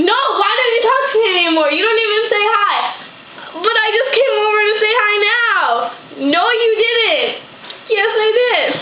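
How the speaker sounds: acted mock conversation. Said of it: "impersonation of me and Justin have a conversation".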